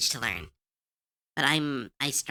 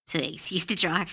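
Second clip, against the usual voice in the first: ordinary delivery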